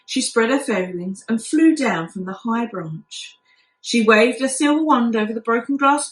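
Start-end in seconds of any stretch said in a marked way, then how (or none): none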